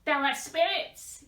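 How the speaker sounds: high, loud, agitated outburst; agitated confession. A narrator's reading. Client confessing to his healer, agitated. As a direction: blurt it out, mildly agitated. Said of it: "more than usually agitated".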